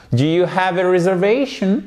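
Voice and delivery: "Do you have a reservation" climbing steadily to the end; high, climbing question tune on "reservation"; rising question intonation. Shown as a demonstration. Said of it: "on va avoir une intonation montante".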